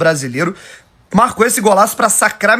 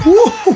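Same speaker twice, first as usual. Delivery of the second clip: excited whoop; 12 semitones higher, 4 dB louder than usual. Real, not acted. Reacting to goal replay.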